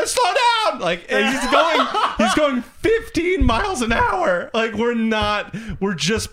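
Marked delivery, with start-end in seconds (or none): 0.1-0.8 high shrieking mock mom; panicky mom. Mom yelling from back seat.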